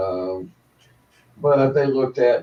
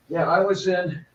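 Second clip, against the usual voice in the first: usual speaking voice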